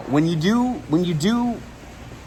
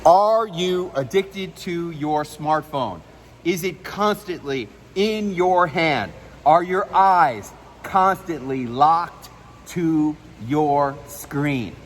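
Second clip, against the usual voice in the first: very slow, measured, emphatic questions; deliberate word-by-word demonstration for a trainee; model delivery; slower than usual. Shown as a demonstration. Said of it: "Say that".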